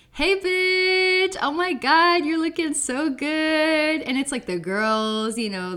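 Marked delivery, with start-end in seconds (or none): 0.1-4.0 high, loud, slow drawn-out diva greeting; mock drag queen welcome, high and loud.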